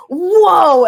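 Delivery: astonished whoa, high and loud. Retold or imitated. Retelling their reaction to a lover's video, astonished. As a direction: let out a big impressed whoa. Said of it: "I literally went".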